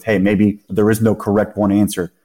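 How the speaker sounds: ordinary talk, nothing marked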